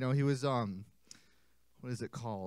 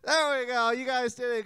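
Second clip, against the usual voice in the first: cheerful praise; 7 semitones higher, 4 dB louder than usual. Shown as a demonstration. Cheering kids on.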